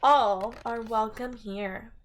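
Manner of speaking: bright, sing-song picture book reading; reading to children. Acted. Teacher reading a rhyming book to her class, sing-song. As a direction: read the rhyme to a class, lilting and lively.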